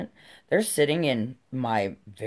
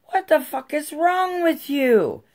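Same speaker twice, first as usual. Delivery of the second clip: loud, very high outraged yell; retold scream; 13 semitones higher, 5 dB louder than usual. Mom screaming at the neighbor who soaked her kids, enraged. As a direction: scream it across the yard, falling at the end. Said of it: "screaming at this bitch".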